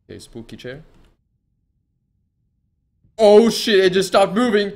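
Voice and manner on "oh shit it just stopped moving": high loud panicked yelling; scared yell. Real, not acted. Streamer yelling at a chair that moves. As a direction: shriek it out, genuinely spooked. Jump scare in horror game.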